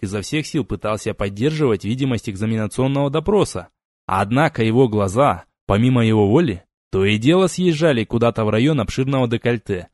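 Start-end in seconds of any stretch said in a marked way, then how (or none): none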